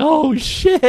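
high, loud mock-scared yelp; startled reaction. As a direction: blurt it out high in comic alarm. Acts out own startled reaction.

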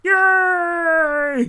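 high excited frog shout; Kermit the Frog. Pretend. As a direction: cheer like a puppet frog. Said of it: "do your Kermit the Frog impression".

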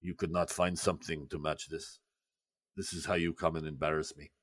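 low, soft muttered reproach; scolding aside. Acted. Khrushchev quietly scolding the woman on his arm. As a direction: hiss a rebuke at his date. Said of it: "you see him go".

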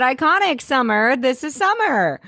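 high-pitched gushing; excited, high acclaim. Real, not acted. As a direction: announce her with rising excitement. Excited praise of a character.

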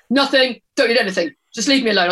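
impatient brush-off; grumpy teen snap. Retold or imitated. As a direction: son brushing off his mum, mock irritable. Son snapping back at mum.

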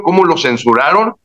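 ordinary talk, nothing marked

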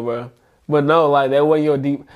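usual speaking voice

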